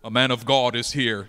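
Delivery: hammed-up confident prophet; mock bold man of God. Preacher mocking how he wished he'd entered, loud. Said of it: "I went in there like this".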